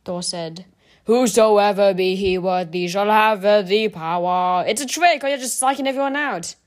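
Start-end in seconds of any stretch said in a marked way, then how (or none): 1.1-4.7 slow, loud, solemn proclamation; grand incantation. Acted. Hammer's inscription recited grandly. 4.7-6.6 insistent. A narrator's reading. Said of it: "Clint insisted".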